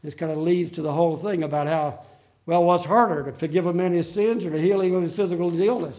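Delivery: ordinary delivery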